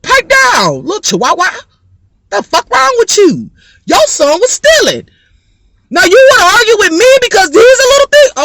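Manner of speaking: high, loud telling-off; loud, fast scolding of the mother; heated comeback. Pretend. Arguing back at the mother.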